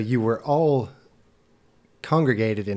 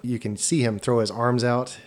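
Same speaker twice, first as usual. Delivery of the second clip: ordinary delivery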